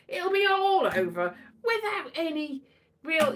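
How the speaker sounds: normal talking voice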